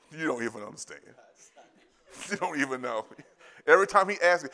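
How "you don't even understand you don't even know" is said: soft laughing. Real, not acted. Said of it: "laughing, moved".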